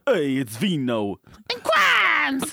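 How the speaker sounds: high, drawn-out announcer yell; hyped-up mock show intro; show title shout. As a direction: holler the title, stretching it out.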